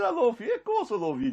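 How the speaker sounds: high-pitched surprised reassurance; re-enacted incredulous reply; startled protest. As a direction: answer high, as if taken aback.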